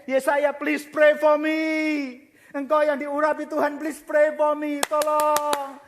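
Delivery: loud, slow begging to the prophet; a king crying out for help; desperate pleading. Acted. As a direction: plead with him to pray for you.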